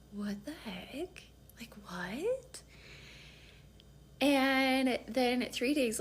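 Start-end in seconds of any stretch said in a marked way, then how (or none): 0.1-2.6 soft tearful whisper. Retold or imitated. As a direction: whisper it alone in the dark. Crying in bed, whispered.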